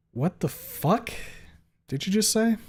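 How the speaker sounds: controlled anger. A narrator's reading. Narrator angry at Stella's question, controlled anger. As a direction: space the words out and rise sharply in pitch. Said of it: "My voice is calm and composed".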